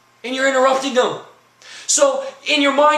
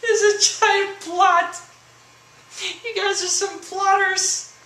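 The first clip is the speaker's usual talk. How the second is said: higher, slow, amused jeer; teasing the women watching, amused and higher; laughing accusation; 8 semitones higher, slower than usual. Real, not acted. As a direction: tease through laughter. Laughing accusation after his joke.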